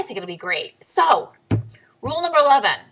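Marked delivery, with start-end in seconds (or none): none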